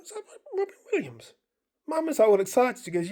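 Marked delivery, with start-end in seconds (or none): 0.0-1.3 high, fast, hushed disbelieving question; stunned disbelief. Retold or imitated. Asking his mate in the dream, stunned disbelief. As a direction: whisper it to your mate in shock. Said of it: "I'm like".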